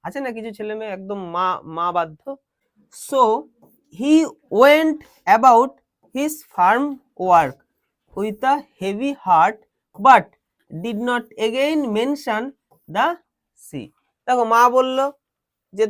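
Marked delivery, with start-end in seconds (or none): 3.1-13.9 very slow reading, pausing between phrases; drawn-out word-by-word classroom reading. Shown as a demonstration. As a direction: dictate it slowly to students. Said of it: "word by word বলছি".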